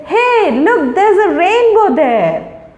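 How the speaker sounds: high, eager "Hey, look"; bright, slow showing-off of a full sentence; excited exclamation. Shown as a demonstration. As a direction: point out a rainbow like a thrilled child. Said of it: "You say".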